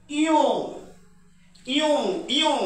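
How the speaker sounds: ইও said three times, each one distinct; nasal vowel drill. Shown as a demonstration. Teacher drills ইও for students, steady repetition. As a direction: model ইও slowly for the class. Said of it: "আমরা উচ্চারণ করব".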